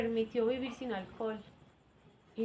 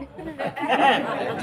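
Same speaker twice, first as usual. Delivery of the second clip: laugh; 3 semitones higher, 8 dB louder than usual. Real, not acted. Laughing at own story.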